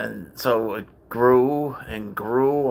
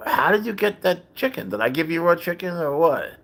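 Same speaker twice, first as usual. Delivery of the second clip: pitched way up in retold disbelief; bewildered, voice jumping high; baffled outburst; 7 semitones higher than usual. To his cat over stolen chicken, baffled and high.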